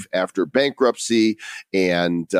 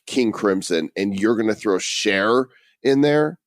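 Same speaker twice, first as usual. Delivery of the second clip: ordinary talk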